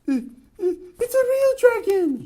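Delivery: high, loud frightened shout. Acted. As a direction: yell the warning in a high panic. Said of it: "shouted Mister Jelly".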